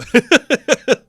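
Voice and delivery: high, loud burst of laughter. Real, not acted. Vlogger cracking up at own joke, loud laugh.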